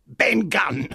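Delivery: high, odd castaway voice; strange voice. Acted. Strange castaway giving his name to the one who found him, strange voice. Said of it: "answered in a strange voice".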